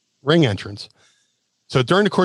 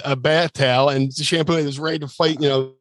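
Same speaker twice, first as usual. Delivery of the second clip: ordinary delivery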